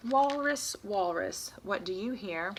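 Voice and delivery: sing-song call to the leopard; reading to children. Acted. Teacher reading aloud to kids, sing-song. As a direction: chant it to the little listeners.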